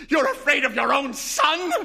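high, loud, accusing shout; furious cry. Acted. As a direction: hurl it at him in fury. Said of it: "she cried".

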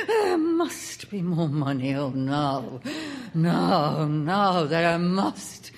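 eerie, quavering house voices; frenzied ecstasy. A narrator's reading. Voices in the house clamour for money in frenzied ecstasy. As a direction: wail like a house gone mad, dying away at the end. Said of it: "simply trilled and screamed in a sort of ecstasy".

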